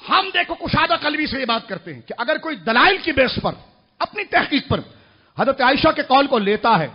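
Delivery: loud, emphatic preacher's delivery; preaching at full force. Real, not acted. Preacher arguing open-mindedness to the crowd, full force. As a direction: drive each clause home loudly.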